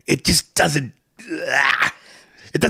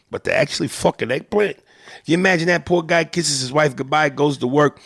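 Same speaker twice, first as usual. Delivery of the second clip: gossiping Italian-American storyteller; incredulous Italian-American neighborhood gossip; old-school Italian-American; 2 semitones higher than usual. Acted. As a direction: tell the guys scandal in Italian-American street talk. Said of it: "and he tells this story".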